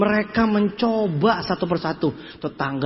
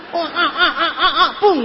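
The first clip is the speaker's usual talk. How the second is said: repeated high grunts then a pop; mock straining noises; 5 semitones higher, 3 dB louder than usual. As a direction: strain in short bursts, then "pung". Mimics bottle coming out.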